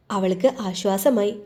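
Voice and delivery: plain reading voice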